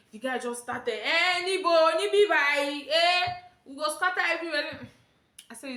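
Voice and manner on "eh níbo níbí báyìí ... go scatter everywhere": high, loud quarrelling in Yoruba; mock outburst. Conductor threatening to scatter everywhere, mock outrage. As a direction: imitate a man kicking off, pitched up.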